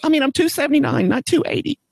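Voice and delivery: high, near-tearful, words rushing out; mock weepy plea about his weight; tearful insistence. Caller near tears insisting on his weight, high and rushed. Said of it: "I'm getting all emotional again".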